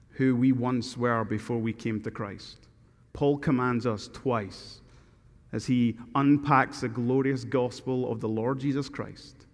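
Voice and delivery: normal talking voice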